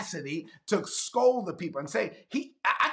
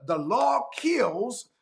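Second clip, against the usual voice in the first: slow emphatic; 3 semitones higher, much slower than usual. Real, not acted. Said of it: "One translation says".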